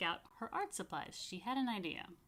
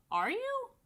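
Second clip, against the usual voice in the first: rising question; 4 dB louder than usual. A narrator's reading. Jennifer questions Jonah.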